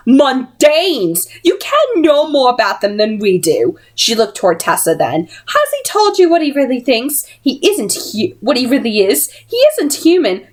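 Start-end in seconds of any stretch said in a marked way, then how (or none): 0.1-3.8 spitting contempt. A narrator's reading. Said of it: "Missus Dark spat". 5.5-10.5 sly taunt. Acted. Missus Dark taunting Tessa.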